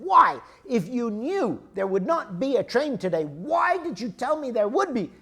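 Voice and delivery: high, loud, outraged demand; angry yell. Acted. Furious traveler confronts the clerk, angry yelling. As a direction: yell it in exasperated accusation. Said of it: "And so I yell at the guy".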